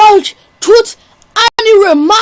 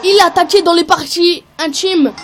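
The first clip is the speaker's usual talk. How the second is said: wrestling commentator. Acted. Play-by-play of the match.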